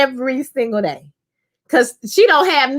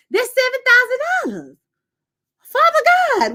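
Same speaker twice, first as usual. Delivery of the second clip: very high-pitched, exasperated disbelief; comic incredulous rant; 12 semitones higher than usual. Real, not acted. Viewer baffled by a character's bills, comic incredulous rant. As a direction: demand answers in a squeaky, worked-up voice.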